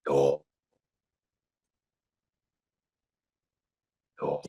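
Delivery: low, sustained "or"; deep warm-up tone held low; as low as you can go. Shown as a demonstration. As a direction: sink the voice right down on "or". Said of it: "like that".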